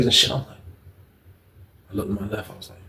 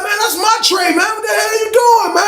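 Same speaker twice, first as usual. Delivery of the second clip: high-pitched shouting as the upset man whose tray got taken; high yell. Retold or imitated. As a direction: shout him down over a stolen tray. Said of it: "he was like".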